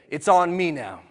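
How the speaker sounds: loud and strong, leading the crowd; with strength. Shown as a demonstration. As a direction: make the room feel the resolve. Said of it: "say it with strength in your body".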